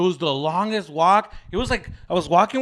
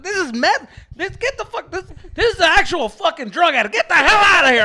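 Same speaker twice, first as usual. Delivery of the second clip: put-on high, loud outraged yell at the girl; mock outraged yell; 10 semitones higher, 8 dB louder than usual. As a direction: shout her out of the party, higher and louder. Yelling at girl with meth pipe.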